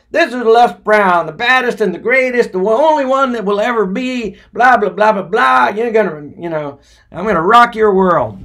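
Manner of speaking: high, loud boastful DJ patter; hyped radio DJ. Retold or imitated. Les on the air for the first time, hyped DJ intro. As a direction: hype the crowd on air.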